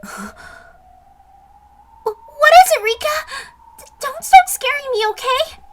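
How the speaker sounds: high, loud scared squeal; squeaky panicked voice; frightened stammer. Acted. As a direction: stutter it out in fright.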